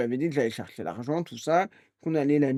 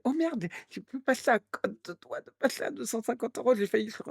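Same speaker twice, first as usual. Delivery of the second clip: higher, flustered scammer voice, gabbling excuses; mock panicked scammer; 8 semitones higher than usual. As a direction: play the scammer caught in his lie. (arnaqueur paniqué, voix plus aiguë)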